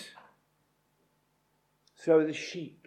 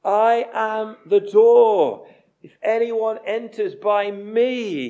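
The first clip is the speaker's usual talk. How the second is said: loud, slow, every word weighted; slow proclaiming; 3 semitones higher, 8 dB louder, slower than usual. Acted. Preacher proclaims Jesus' words to the congregation, loud and slow. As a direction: proclaim it slowly and loudly like a declaration.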